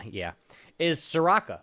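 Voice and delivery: ordinary talk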